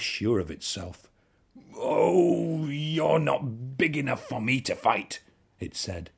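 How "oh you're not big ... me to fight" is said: blustering. A narrator's reading. Ladybird backs down, blusters.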